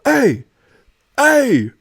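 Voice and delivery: high, loud sing-song calls of hey; hollering at a passerby from down the street; drunk homeless guy calling out. Retold or imitated. Homeless man hollers at the teen walking into the store, high and loud. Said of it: "I hear this guy go".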